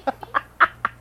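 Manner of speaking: high, helpless giggle; laughing. Real, not acted. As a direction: crack up at yourself. Cracks up at own silliness.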